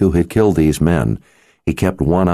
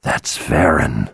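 contained rage; 4 semitones lower, slower than usual. A narrator's reading. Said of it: "Rage twisted his face".

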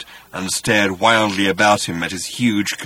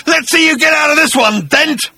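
high, loud taunting scream; 6 dB louder than usual. Acted. Agrajag daring Arthur to escape, screamed taunt. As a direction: scream the challenge at your enemy. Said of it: "he screamed".

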